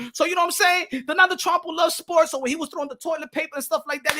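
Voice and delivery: normal talking voice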